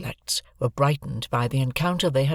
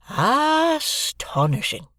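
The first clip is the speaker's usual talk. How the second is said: "Astonishing!" much higher, very slow, rising; drawn-out wonder; dazed astonishment; 13 semitones higher, much slower than usual. A narrator's reading. Joe against the wall, stunned, slow rising "Astonishing!". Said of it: "backed up against a wall".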